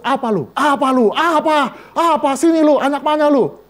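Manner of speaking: a mock-tough "Apa lu?" hurled across the hangout; mock thug challenge. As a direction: pick a fight like a campus thug, half-acted. Acted street quarrel at hangout.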